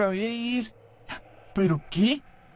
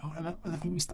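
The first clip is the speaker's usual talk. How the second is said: timid murmur; 3 semitones lower, 11 dB quieter, much faster than usual. Retold or imitated. Timid retold greeting.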